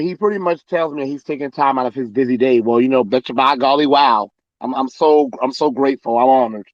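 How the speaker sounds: ordinary talk, nothing marked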